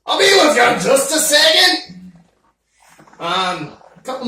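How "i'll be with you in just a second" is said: louder, higher call from far off; busy shopkeeper calling out; calling from back. Acted. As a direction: shout back from another room.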